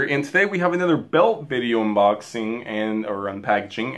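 ordinary talk, nothing marked

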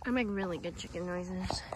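low whispered aside. Real, not acted. Whispering to the viewers beside the chickens. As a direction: whisper it so the birds don't hear.

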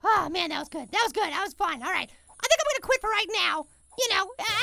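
usual speaking voice

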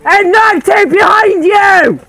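loud, high, slow shouted warning. Real, not acted. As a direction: bellow the alert to a teammate. Warning teammate in combat.

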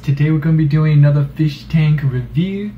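hammed-up European boy accent to open the show; mock European accent. YouTuber opening his fish tank review in a mock European accent. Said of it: "Just trying to be a cool European boy".

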